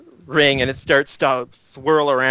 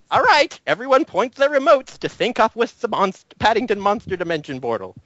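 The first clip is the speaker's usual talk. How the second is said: higher, showy announcement; eager professor; 6 semitones higher than usual. Acted. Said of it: "professor commands".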